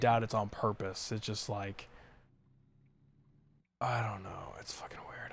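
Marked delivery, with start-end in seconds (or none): 3.8-5.3 under the breath, unvoiced; whispering. Real, not acted. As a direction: whisper it to yourself, half annoyed. Muttered aside while playing.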